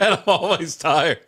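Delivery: slow, laughing repeat of the line; laughing echo. Real, not acted. Echoing a friend's tired line, laughing slowly. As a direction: laugh your way through it slowly.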